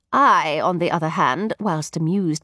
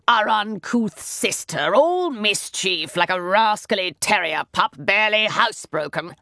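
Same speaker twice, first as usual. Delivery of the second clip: a hint of a stuffy brother ranting. A narrator's reading. Mycroft ranting about his sister in the cab, lightly pompous. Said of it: "Mycroft ranted on".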